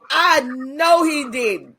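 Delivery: slow, drawn-out disbelief; lightly voiced daughter; daughter's disbelief. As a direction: daughter reacting to the guard.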